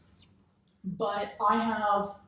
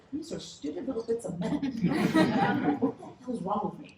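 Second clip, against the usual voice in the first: laughing self-mockery; 4 semitones higher than usual. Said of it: "my logical brain says".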